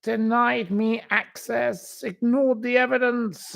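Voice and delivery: high, pompous mock-victim voice; mock indignant reading. Mocking her statement to viewers, in a high indignant voice. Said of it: "I'm giving this gaps 'cause I can't be bothered".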